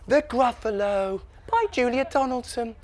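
high, soft, slow story-time voice; teacher reading a title. Acted. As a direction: announce a book title dreamily to a class. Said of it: "the bit where they go".

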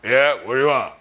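lightly voiced gruff doorman. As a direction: answer the door like a curt bouncer. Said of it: "the guy opens the door".

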